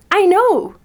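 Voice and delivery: "I know." thrown up high and loud with glee; delighted, not cocky. Retold or imitated. To an admirer praising her scent, a thrilled high "I know.". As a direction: squeal "I know." like you're thrilled to hear it. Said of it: "In a".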